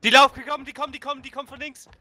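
high, alarmed calling out; urgent warning. Real, not acted. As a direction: warn that they're coming, fast. Enemies approaching, warning teammate.

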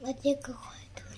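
soft unvoiced whisper. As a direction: whisper it secretly. Whispered exchange.

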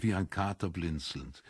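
ordinary delivery, nothing marked

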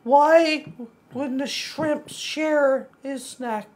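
high, slow cartoon mouse voice; squeaky, slowed-down Mickey impression. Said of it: "my sounds like Mickey".

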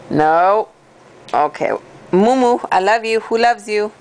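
normal talking voice

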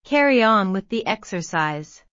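ordinary talk, nothing marked

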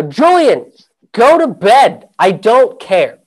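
sleepy roommate snapping loudly; annoyed yell. Retold or imitated. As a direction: yell at him to go to bed. Woken by knocking at 4:30.

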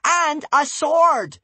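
high, loud, slow defiant cry; shouting. A narrator's reading. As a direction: brandish the word sword. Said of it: "shouted Wish".

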